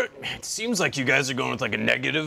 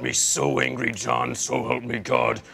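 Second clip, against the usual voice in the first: mock anger; 4 dB quieter than usual. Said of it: "I'm getting real angry now".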